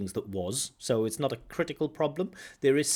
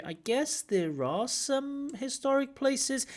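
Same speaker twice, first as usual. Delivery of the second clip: slow, much higher, with a long drawn-out melody on "I guess there are some historic places"; stretched-out doubtful tune; 14 semitones higher, slower than usual. Retold or imitated. Examiner shows student's doubtful intonation, slow and high. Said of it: "Love the intonation here".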